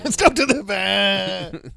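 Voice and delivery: high, slow echo of the line; hammed film line. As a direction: repeat it high and drawn out. Echoing the film line louder.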